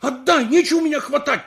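high, loud indignant demand; outraged yell; shouting. Acted. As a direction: shout it at the man grabbing you. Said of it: "кричу ему".

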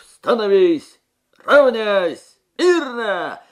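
very loud, high parade-ground shouts; barked drill commands. A narrator's reading. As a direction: bellow each command at the platoon. Sergeant barking drill commands.

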